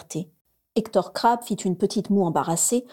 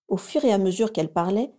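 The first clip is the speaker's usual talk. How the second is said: ordinary delivery, nothing marked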